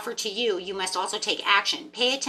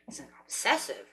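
muttered to herself; 5 semitones lower, 9 dB quieter than usual. Retold or imitated. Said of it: "sitting there thinking".

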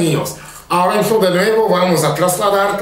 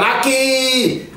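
put-on kids shouting; 5 semitones higher than usual. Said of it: "Y los niños gritan".

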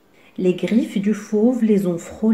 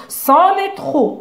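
higher, louder, slow and offended; indignant; 7 semitones higher, 5 dB louder, slower than usual. A narrator's reading. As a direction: lose patience. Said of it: "s'indigne Zigomar".

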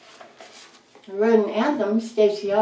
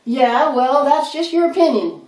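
loud, higher retort; yelled comeback; 5 semitones higher, 7 dB louder than usual. A narrator's reading. The rat's team answers the rabbit hecklers, yelled back. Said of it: "We yelled back".

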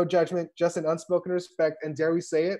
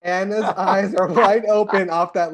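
laughing; 6 dB louder than usual. Real, not acted. Narrator laughing after cast joke.

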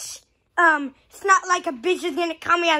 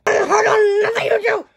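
normal talking voice

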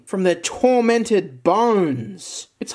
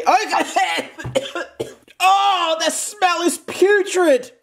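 high disgusted outburst, coughing; disgusted shock; 9 semitones higher, slower than usual. Real, not acted. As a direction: gag at a foul smell. Reacting to putrid smell.

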